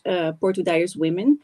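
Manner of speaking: usual speaking voice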